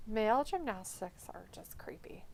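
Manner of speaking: whispered aside; soft whispered aside. Real, not acted. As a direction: whisper it like a secret. Whispered aside while streaming.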